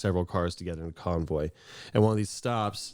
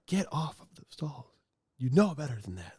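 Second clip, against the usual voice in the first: higher-pitched scolding dad impression; retelling dad yelling at kids, mock stern; 6 semitones higher than usual. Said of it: "It's louder than that".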